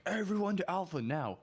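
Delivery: high, drawn-out mock command; Trigger calling orders. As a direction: imitate a platoon leader calling everyone over. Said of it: "Trigger's like".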